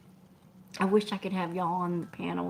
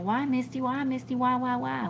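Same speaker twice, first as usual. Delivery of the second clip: mock whine; 6 semitones higher, 3 dB louder than usual. Real, not acted. Mock whining at a friend.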